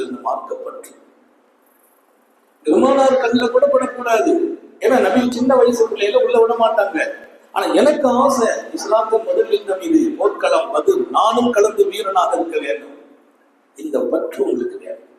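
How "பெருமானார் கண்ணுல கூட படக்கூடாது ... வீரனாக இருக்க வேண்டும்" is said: put-on high, loud, eager boy's voice; a boy pleading from his heart, pitched high and loud; eager young boy. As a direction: voice the boy's burning wish to fight. Boy hiding, voicing his wish.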